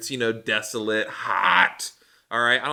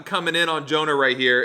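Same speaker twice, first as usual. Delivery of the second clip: ordinary talk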